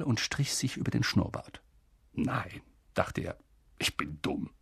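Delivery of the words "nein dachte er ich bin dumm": whispering. Acted. Thought, quietly to himself.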